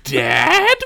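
high, loud startled squeak; surprised. Acted. Bard recognising his father in the crowd, startled high cry. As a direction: blurt it in shock. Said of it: "Vlog said, surprised".

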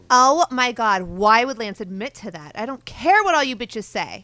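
loud, outraged troll voice; online troll. Acted. Co-host reads Pistolo's comment aloud, loud hammed-up outrage. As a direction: declaim it like an indignant internet commenter, drawing out phrases. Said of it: "in the way we think the online troll would sound".

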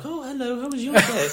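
high, chirpy theme-park greeter; mock the sugary character voice; mock character greeting. Mate mimicking a Disney character greeting kids, high and chirpy. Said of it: "be like".

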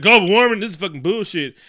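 loud angry mock rant; pissed off. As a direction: imitate a fuming stepdad ranting at the news. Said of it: "he is fucking pissed off".

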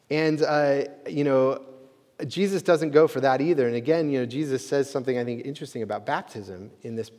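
normal talking voice

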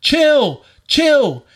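high, drawn-out repeated command; retold pissed off. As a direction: re-enact exasperation, hitting each repeat. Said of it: "I just kept telling her".

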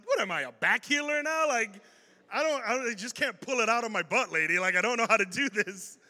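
loud, high flustered protest; comic exasperation. Retold or imitated. Pastor asked to heal another back, loudly exasperated. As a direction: protest helplessly, playing the panic for laughs. Flustered reaction to healing request.

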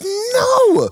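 high wailing no; a mock wail of no; mock horrified no. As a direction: cry out no in disbelief. Reacting to bottles thrown.

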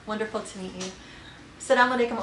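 normal talking voice

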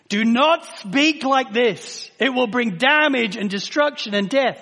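loud, slow, punched warning; preaching at full force; forceful warning. Acted. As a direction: drive the warning home. Preaching forbidden speech.

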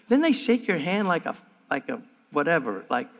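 ordinary talk

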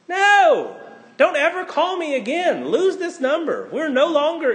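hammed-up offended refusal; mock shout at a friend calling at night; mock outrage.

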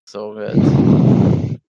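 slow, breathy, airy whisper; heavy breathing. Pretend. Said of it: "breathe into the mic a little bit harder".

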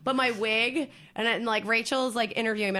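ordinary talk

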